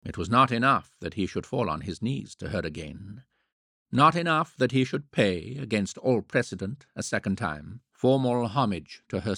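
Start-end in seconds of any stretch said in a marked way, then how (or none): none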